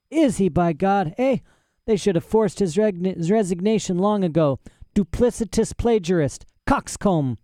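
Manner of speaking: put-on high-pitched, outraged old scholar; indignant mad professor. Old professor rants about rival Trelawney, indignant.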